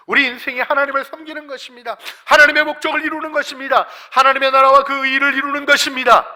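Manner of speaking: high, loud pulpit proclamation; impassioned preaching. Real, not acted. Preacher declaring life's purpose in serving God, full-force preaching. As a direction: proclaim it to the whole congregation.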